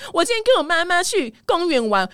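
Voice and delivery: put-on high, taunting baby voice; mocking kid voice. Classmate teasing a kid, high mocking baby voice. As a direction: sneer it like a schoolyard bully.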